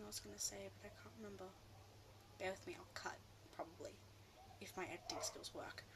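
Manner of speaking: unvoiced whisper, like a secret to the camera; whispered aside. Real, not acted. Vlogger whispering to viewers about cutting this, hushed whisper. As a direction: breathe it out as a quick private aside.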